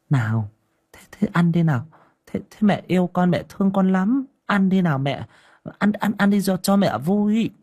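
put-on high, sweet coaxing to a toddler; coaxing mother. Mother coaxing her little son to eat, sweetly coaxing. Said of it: "ra sức nịnh nọt".

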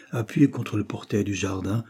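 plain reading voice

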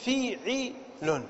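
فيعل very slowly as fi-'i-lun; syllable-by-syllable demonstration. Shown as a demonstration. Teacher giving the pattern of ibilun, فيعل slowly as fi-'i-lun. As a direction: sound out فيعل as fi... 'i... lun, softly separated. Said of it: "فنقول".

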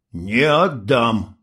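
slow, dogged refusal; stubborn. A narrator's reading. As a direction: drag it out stubbornly. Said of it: "упрямо повторил юноша".

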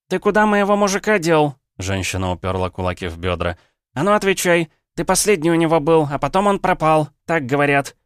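high, loud brawling woman's voice, fists on hips; shrill village woman. Acted. Angry wife demands her missing man, high, loud yelling. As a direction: yell it at him like a market scold. Drunk woman confronting the hero.

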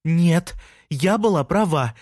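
insistent, lightly shouted retort; exclaiming. A narrator's reading. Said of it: "воскликнула, дожевывая бутерброд".

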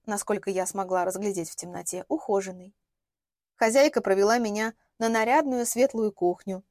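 usual speaking voice

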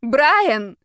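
loud desperate cry of a name. A narrator's reading. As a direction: call out his name in anguish. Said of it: "Сильнейший крик вырвался из моей груди".

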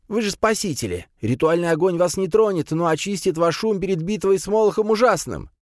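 high-pitched zealous priest voice; priest character. A narrator's reading. Priest explains the ritual fire, high zealous voice. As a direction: reassure them in a high, preaching cry.

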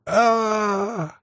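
high, sustained cry of agony; scream of pain. A narrator's reading. Narrator screaming under torture.

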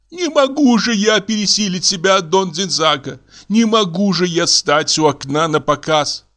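high-pitched, distressed woman; theatrical feminine indignation; tearful protesting wife. Acted. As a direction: refuse in a high, upset voice.

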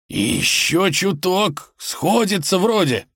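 high, straining wolf; wolf rasping under strain; hoarse wheezing. A narrator's reading. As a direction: wheeze it out with effort. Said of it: "хрипел волк".

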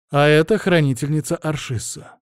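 ordinary delivery

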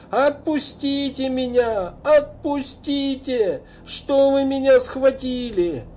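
put-on high, slow drunken yelling; drunken shout. A narrator's reading. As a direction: bellow it drunk on the street. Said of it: "громко на всю улицу закричал". (пьяный крик на всю улицу)

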